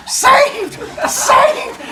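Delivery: very high mock-heroic cry; gleeful calling out. Teacher as kid saver tossing children, gleeful high call. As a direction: sing out each rescue with a laugh.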